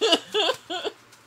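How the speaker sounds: big loud laugh; loud burst of laughter. Real, not acted.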